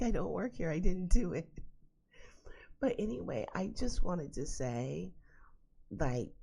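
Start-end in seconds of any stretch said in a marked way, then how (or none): 0.0-1.5 high-pitched, pleading mock protest. Customer to yelling Italian restaurant man, high mock protest. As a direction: plead innocence in a raised pitch.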